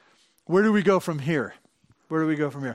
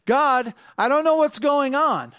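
higher, louder anguished plea; desperate prayer to God, pleading; crying out to God; 8 semitones higher, 4 dB louder than usual. Retold or imitated. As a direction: call out to God in helpless confusion. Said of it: "cry out to God and say".

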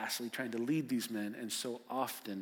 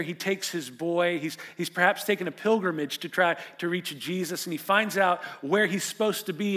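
raised, louder sermon voice building pathos; impassioned preaching; 6 semitones higher, 9 dB louder than usual. Acted. Pastor to congregation about the suffering boy, impassioned preaching. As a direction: preach it out with full force. Preacher retelling the father's search.

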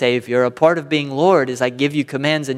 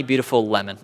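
usual speaking voice

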